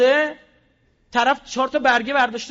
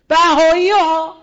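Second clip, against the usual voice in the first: louder and drawn out, hammering the word; emphatic slow shout; 6 semitones higher, 11 dB louder, slower than usual. Real, not acted. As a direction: spit out the name slowly and loudly. Angry exposé of Bahais. (فریاد کشیده و کوبنده)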